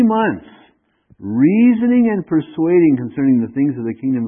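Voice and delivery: loud, driving sermon reading of scripture; forceful preaching. Acted. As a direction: proclaim it to the whole congregation. Reads scripture at full force.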